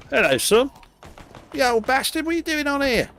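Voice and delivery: high squeaky taunting voice; mock old gamer. Imitating a cocky player taunting a kid, high squeaky voice. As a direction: sneer like a cocky online player. Mocking voice chat exchange.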